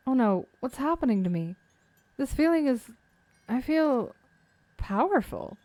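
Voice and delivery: lightly voiced anime heroine; a hint of awed magical girl; bright magical girl. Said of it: "magical girl transformation".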